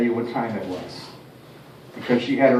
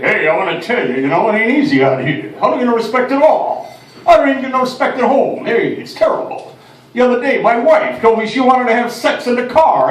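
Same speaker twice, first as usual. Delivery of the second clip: loud, higher, put-upon comic whine; no-respect stand-up voice, louder and higher; Rodney Dangerfield impression; 7 semitones higher, 5 dB louder than usual. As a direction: complain like the guy who gets no respect. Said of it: "Rodney Dangerfield".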